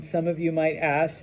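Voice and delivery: ordinary talk, nothing marked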